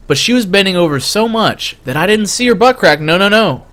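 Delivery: usual speaking voice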